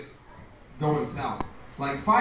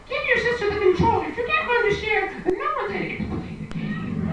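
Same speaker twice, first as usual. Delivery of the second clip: much higher mom voice, scolding; shrill scolding mom. Retold or imitated. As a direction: scold like an exasperated mom, pitched way up. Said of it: "my mom used to do the same thing".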